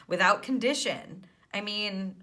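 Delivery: usual speaking voice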